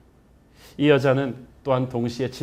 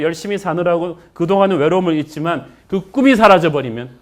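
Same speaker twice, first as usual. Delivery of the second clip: louder, higher preacher's build; fiery preaching; 5 semitones higher, 8 dB louder than usual. Real, not acted. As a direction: preach it with rising force. Preaching builds to full force.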